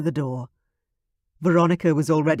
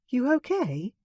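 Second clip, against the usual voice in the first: put-on young woman's voice; 9 semitones higher than usual. Veronica asks Mom.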